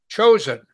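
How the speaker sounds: Chosen punched high and loud; stress on Chosen. Shown as a demonstration. Teacher emphasizing Mary's choice to the class, stressed Chosen. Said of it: "I emphasize that word".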